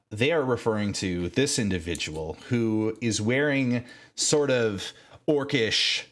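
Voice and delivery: ordinary delivery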